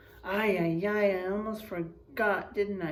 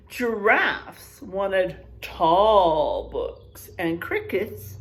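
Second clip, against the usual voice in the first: storybook reading; 5 dB louder than usual. Acted. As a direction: paint each animal for the kids. Reading picture book aloud.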